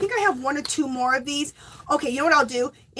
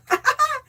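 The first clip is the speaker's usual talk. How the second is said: giggle; 14 semitones higher than usual. Real, not acted. Laughs at bubbles.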